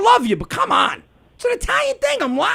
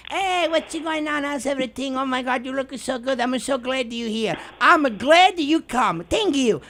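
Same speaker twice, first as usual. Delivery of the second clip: sweet fake aunt; 2 semitones higher than usual. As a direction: gush sweetly to a guest's face. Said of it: "my aunts talking shit".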